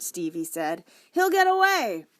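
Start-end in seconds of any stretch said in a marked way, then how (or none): 1.1-2.1 put-on high, loud worried boy; pleading kid voice. A narrator's reading.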